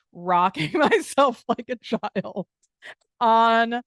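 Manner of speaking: laughing while telling. Real, not acted. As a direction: giggle out the memory of rocking on the floor. Laughs recalling rocking on floor.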